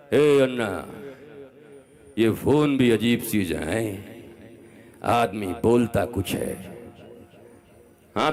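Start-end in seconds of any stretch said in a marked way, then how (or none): 0.1-6.7 slow, drawn-out film-dialogue impression; imitated screen hero, unhurried; Amitabh Bachchan in Agneepath. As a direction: stretch the words out like a movie star's famous line. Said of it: "Agnipath एक picture थी, उसमें कहता है".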